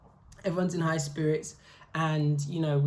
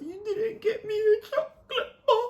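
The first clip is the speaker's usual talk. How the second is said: very high mock sob; hammed-up tearful whine; imitated crying. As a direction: wail it and dissolve into laughing sobs. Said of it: "she started crying".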